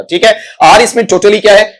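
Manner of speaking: ordinary talk, nothing marked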